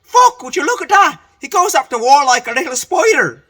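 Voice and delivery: high, loud put-on voice of an astonished drunk; imitating a mate marvelling; amazed exclamation. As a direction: shout it out, amazed at the climb. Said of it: "Biddy said to".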